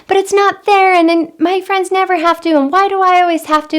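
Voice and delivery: put-on high, loud sassy kid whining; whiny complaining child. Mom voicing her child complaining, in a high whiny voice. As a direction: whine it like a kid who thinks it's unfair. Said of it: "complaining or sassing and saying".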